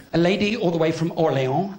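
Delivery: ordinary talk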